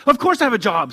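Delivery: loud, high indignant snap; snapped. A narrator's reading. Mother snapping at the clerk, loud and indignant. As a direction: bite back, offended. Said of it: "snapped Emily".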